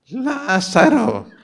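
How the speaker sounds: a weak, voice-cracking call; mock-timid call that breaks off; feeble Lázaro call. Shown as a demonstration. Pastor mocking a weak call to Lazarus. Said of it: "que se le salió el, no, él no tiene ningún gallo, desafinó".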